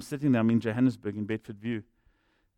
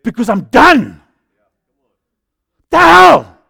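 furious full-throated yell, much higher and louder; screaming at the devil; 11 semitones higher, 19 dB louder, much slower than usual. Retold or imitated. Pastor reenacting screaming at the devil in the car, furious yell. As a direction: roar your rage at the devil. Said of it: "and I'm shouting, and I'm screaming at the devil".

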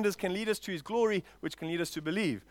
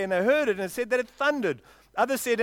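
usual speaking voice

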